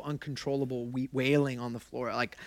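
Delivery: ordinary talk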